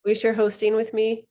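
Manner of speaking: ordinary talk, nothing marked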